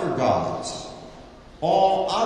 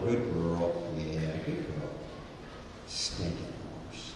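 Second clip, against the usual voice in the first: low hushed aside to horse; 10 semitones lower, 11 dB quieter than usual. Acted. Voicing soothing then muttering at horse.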